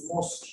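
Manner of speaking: "Mosque" said as "mos-kyu"; mispronounced mosque. Retold or imitated. Teacher imitating a student who goes to pray, mispronounced mosque. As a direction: add a "kyu" ending to "Mosque". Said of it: "lots of people say Mosque".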